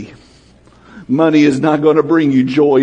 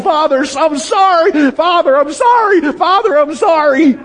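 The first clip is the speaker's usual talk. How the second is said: desperate repeated apology; 11 semitones higher than usual. Pretend. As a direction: beg forgiveness over and over at full voice. Sinner repeating the same apology.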